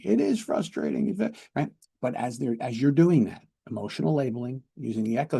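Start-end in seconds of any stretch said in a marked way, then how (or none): 0.0-1.4 high, emphatic exasperated agreement; acted frustration. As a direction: burst out in heated agreement. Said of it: "I imagine that is very frustrating".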